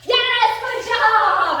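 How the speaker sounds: high, loud, stretched whoop; thrilled praise. Real, not acted. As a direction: burst into proud cheering for the dog. Praising dog's unprompted success.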